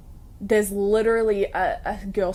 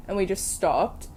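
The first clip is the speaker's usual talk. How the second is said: ordinary talk, nothing marked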